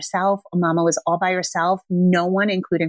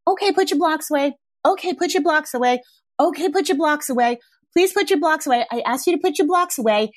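higher, pleading repeats; pleading, ineffectual; 6 semitones higher than usual. Shown as a demonstration. Mom begging child to tidy blocks, high pleading voice. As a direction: ask again and again without conviction. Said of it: "definitely not like".

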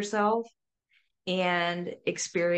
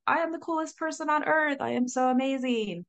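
high, quick, hammed-up self-praise; mock self-adoration; 7 semitones higher than usual. Imitating someone magically confident once sober, mock self-praise. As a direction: gush mockingly about yourself, pitched up. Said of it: "magically you're like".

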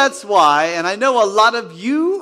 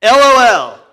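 letters called out loud and separate; loud spelled-out LOL; 3 semitones higher, 8 dB louder than usual. Shown as a demonstration. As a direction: belt out L O L. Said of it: "let's do it".

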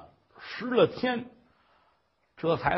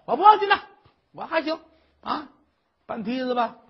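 put-on stubborn old mother; 9 semitones higher, 5 dB louder than usual. Mother insists on climbing up.

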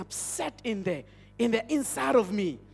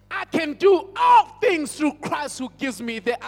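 raised, forceful preacher's proclamation, higher and louder; defiant declaration; 5 semitones higher, 6 dB louder, slower than usual. Retold or imitated. Preacher retelling how he faced down his fear, defiant proclamation. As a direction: declare it slowly and boldly, building to a shout.

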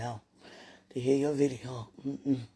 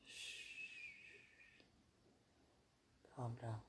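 hushed whisper, talking herself down; whispered self-calming; 13 dB quieter than usual. Real, not acted. To herself while overwhelmed, a soft soothing whisper. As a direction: breathe the words out softly to settle yourself. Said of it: "Oh, I gotta calm down".

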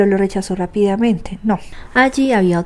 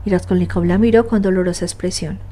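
plain reading voice

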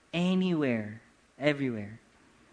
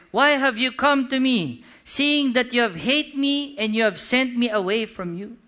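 loud, higher-pitched confrontation; Isaac; 7 semitones higher, 8 dB louder than usual. Acted. Isaac asking visitors who hated him why they came, loud protest. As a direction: challenge them with a raised voice, aggrieved.

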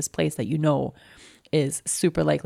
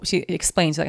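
usual speaking voice

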